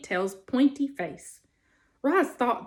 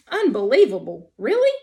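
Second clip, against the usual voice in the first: incredulous possum; 6 dB louder than usual. Acted. Possum answers the robot, incredulous and amused. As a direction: toss the word back, tickled.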